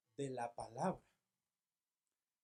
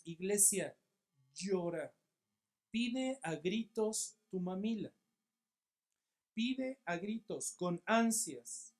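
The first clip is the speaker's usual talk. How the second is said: loud, higher, drawn-out plea; urgent exhortation; 3 semitones higher, 6 dB louder, slower than usual. Retold or imitated. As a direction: preach it like an urgent demand, slowly. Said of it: "Pedro está diciéndonos".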